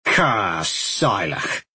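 Carsilach drawn out very slowly, each syllable; sounding out. Acted. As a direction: sound out Carsilach like a foreign word. Badger mouths strange word slowly.

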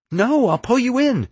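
very high, urgent objection; frantic protest. A narrator's reading. Frantic argument during rescue.

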